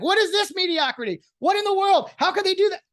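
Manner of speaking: high-pitched, outraged fan squeal; mock outrage. Fan imagining his team's bad start, squealing in mock outrage. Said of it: "I'll be like".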